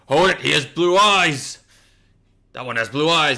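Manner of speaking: shouted high and loud, pointing someone out; alarmed call-out. Retold or imitated. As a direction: yell a warning to the others. Night's Watch men calling out.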